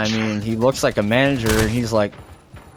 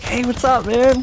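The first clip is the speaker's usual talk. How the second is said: higher, friendly mock greeting; imitating the manager; 13 semitones higher than usual. Manager greeting the customer, lightly mimicked higher. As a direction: pitch up for a cheery hello.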